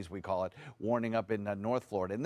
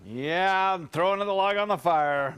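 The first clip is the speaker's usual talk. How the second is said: loud joking; 7 semitones higher, 6 dB louder, slower than usual. Real, not acted. Weatherman jokes about cold.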